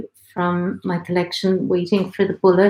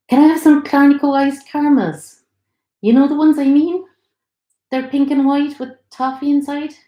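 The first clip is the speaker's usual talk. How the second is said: higher, eager pleading for sweets; a hopeful craving woman begging a treat; eager pregnant Marilyn; 7 semitones higher, 4 dB louder than usual. Acted. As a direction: pregnant woman asking her partner for caramels, higher and eager.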